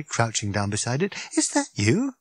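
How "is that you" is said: high, startled question; sharp. Acted. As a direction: ask it in sharp disbelief. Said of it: "said Arthur sharply".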